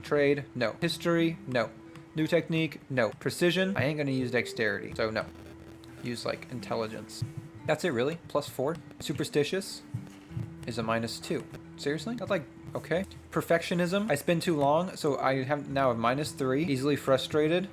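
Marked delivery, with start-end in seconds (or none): none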